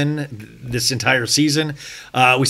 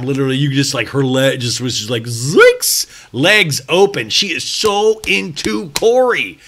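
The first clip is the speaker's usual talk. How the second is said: normal talking voice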